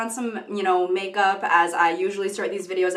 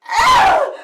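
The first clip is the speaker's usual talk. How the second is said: short high imitated scream; Divergent scream; 9 dB louder than usual. Imitating Shailene Woodley's Divergent scream, a short high shriek. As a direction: let out one sharp high scream. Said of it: "Shailene Woodley's Divergent scream when she goes".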